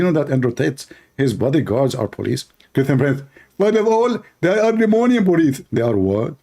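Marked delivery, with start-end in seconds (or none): 2.7-5.6 high, petulant caricature; lampooned rival refusing to leave; mock debater. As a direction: whine the threat higher.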